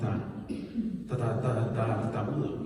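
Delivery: a timid man tripping over every syllable; mock stutter from an excuse-making Moses; stammering Moses. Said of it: "cuando Dios llega y llama a Moisés".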